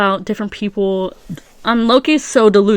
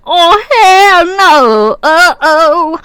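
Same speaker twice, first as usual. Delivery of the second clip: high, loud, very slow oh hell no; mock alarm; 9 semitones higher, 6 dB louder, much slower than usual. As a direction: stretch it out in playful shock. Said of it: "I was like".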